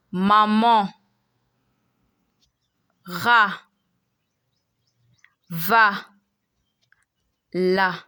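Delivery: "maman, ra, va, la." with open ah vowels; French A sound. Shown as a demonstration. Teacher reads the example list to learners, careful French pronunciation. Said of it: "That's the A sound".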